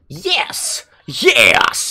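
high, punchy heroic "yes"; over-the-top victory cry; Captain Falcon. Retold or imitated. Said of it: "He sounded like Captain Falcon right there".

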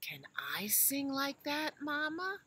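high, whispered little elephant; high child voice. Acted.

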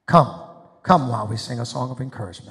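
ringing shouted call to come forward; preacher's loud altar call; fervent call. Real, not acted. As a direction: call them up with full force. Preacher calls people forward.

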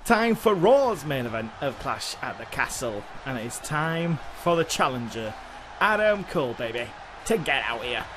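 announcer voice. Acted. Announcing match like commentator.

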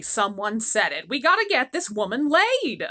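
ordinary delivery

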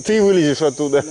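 ordinary delivery

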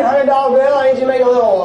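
ordinary talk, nothing marked

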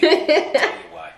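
high booming reveal. Acted. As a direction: bellow it like a dramatic reveal. Podcast host mock announcement.

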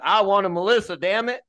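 put-on tossed-off Southern accent complaint. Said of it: "I don't know where this Southern accent just came from".

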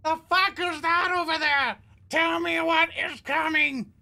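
high, frantic shout; panicked voice calling out; in-character yell. Acted. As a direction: yell it out in alarm. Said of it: "I go".

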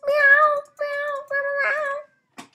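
high, loud cat meows; squeaky playful kitten meows; mock meowing. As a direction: meow like a cat at the top of the voice. Imitating the kitty mob.

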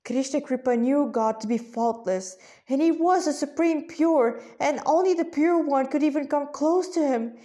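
usual speaking voice

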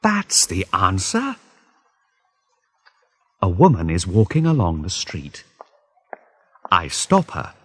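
ordinary delivery

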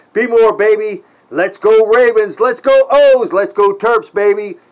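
loud sports chant; rally the home teams, loud; shouting hometown team pride; team cheer. Real, not acted.

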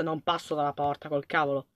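soft scared aside; hushed refusal. Real, not acted. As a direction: keep it under your breath so nothing hears. Scared player hiding in horror game.

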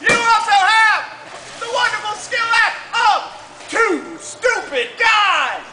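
booming showman announcement; carnival barker. Acted. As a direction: sell it to the crowd.

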